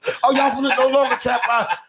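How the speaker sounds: high-pitched excited party host. Retold or imitated. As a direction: imitate a host hailing guests in a high voice. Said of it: "[name] would've came over there dancing".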